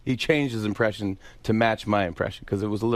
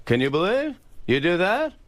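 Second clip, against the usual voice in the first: high rising questions building to a slow yell; Jerry Seinfeld. Shown as a demonstration. Guest demonstrating the different Jerrys, high building impression. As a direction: pitch it up, then shout the last line. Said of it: "There's three different Jerrys".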